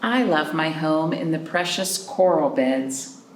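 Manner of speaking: ordinary talk, nothing marked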